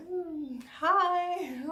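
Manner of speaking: high, loud, uncomfortable hello; acted awkward hi under staring eyes; awkward greeting. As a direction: force out a strained little hi. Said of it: "It's so uncomfortable".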